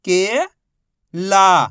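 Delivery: each syllable held, long pause between; first syllable rising, then a separate second. Shown as a demonstration. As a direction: sound it out for beginning readers. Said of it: "एक ही अक्षर का उच्चारण करके".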